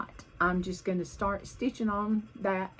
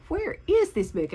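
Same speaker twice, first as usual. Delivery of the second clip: higher, louder puzzled question; puzzled search; 8 semitones higher, 4 dB louder than usual. Retold or imitated. Recalling the hunt for her missing book, puzzled. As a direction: ask in mock bewilderment.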